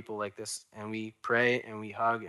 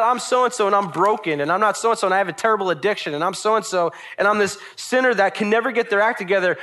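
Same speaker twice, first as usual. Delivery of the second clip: mock self-condemnation; 7 semitones higher, 5 dB louder than usual. Acted self-condemning believer rattles off faults.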